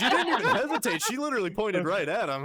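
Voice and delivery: high, loud, laughing disbelief. Real, not acted. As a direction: crack up while pointing out what she did. Listener cracking up at instant answer.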